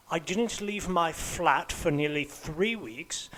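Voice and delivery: normal talking voice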